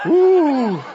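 high, drawn-out feigned dread; mock fearful response. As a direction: quaver an exaggerated frightened ooh. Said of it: "We should go".

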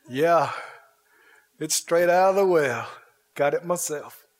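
lightly voiced battle-worn man; modest soldier. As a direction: answer the king low and matter-of-fact. Proud soldier answers the king.

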